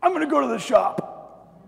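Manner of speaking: high, loud put-on indignation; hammed-up offended exit; mock huffiness. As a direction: announce a storm-off, high and strained. Acts storming off from wife.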